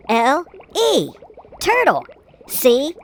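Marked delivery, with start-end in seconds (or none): none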